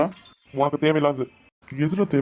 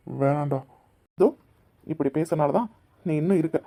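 ordinary talk

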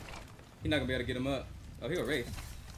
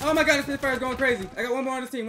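excited; 10 semitones higher, 8 dB louder than usual. Real, not acted. Excited during firefight.

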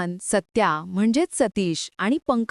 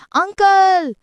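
high, loud, delighted cry; joyful shout; 8 semitones higher, 5 dB louder, much slower than usual. A narrator's reading. Niece spotting her uncle at last, joyful shout. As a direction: cry out in happy relief. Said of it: "ती आनंदाने ओरडते".

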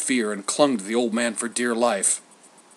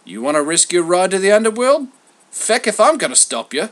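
high, loud, slangy messenger voice; cheeky messenger god; 7 semitones higher, 7 dB louder than usual. A narrator's reading. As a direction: rattle off gossip like a brash errand lad. Hermes answers in character.

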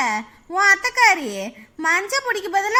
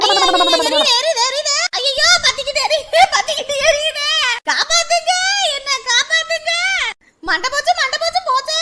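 hammed-up panicked cries, higher and half laughing; frantic comic yelps for help; mock panic; 6 semitones higher, 3 dB louder than usual. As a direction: play a comic fake panic. Mother pretends her head burns.

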